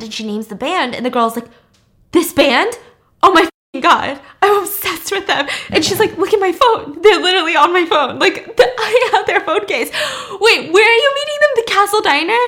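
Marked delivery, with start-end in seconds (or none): 2.1-12.4 much higher, louder, giggly fangirl squeal; starstruck teen girl. Retold or imitated. Schoolgirl squeals at news of her favorite band. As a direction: gush like a teen meeting her idols.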